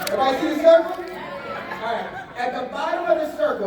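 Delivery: calling out to the room. Real, not acted.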